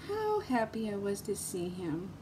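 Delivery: a quiet fond repeat, almost under the breath; soft echo. Real, not acted. Telling viewers about her friend, softly repeating herself.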